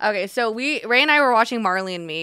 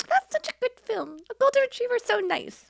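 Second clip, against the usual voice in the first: gushing high voice. Retold or imitated. Own retold gushing, very high.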